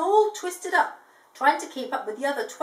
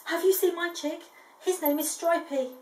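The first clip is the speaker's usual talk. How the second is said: desperate; 7 semitones higher than usual. A narrator's reading. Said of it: "He was feeling desperate by now".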